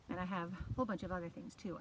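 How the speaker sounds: ordinary talk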